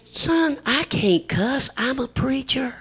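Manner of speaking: high, slow protest; pleading preacher. Retold or imitated. Preacher telling boy he cannot cuss, high slow plea.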